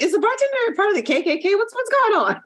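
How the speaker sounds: high, rising alarmed question; sudden suspicious shock. Retold or imitated. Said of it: "I was like".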